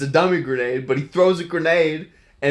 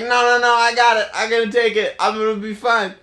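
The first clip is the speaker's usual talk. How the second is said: high, loud insistence through the pain; determined shout; 9 semitones higher, 5 dB louder than usual. Retold or imitated. Steve telling the scientists to keep going, high determined yell. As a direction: push through it, refusing to stop.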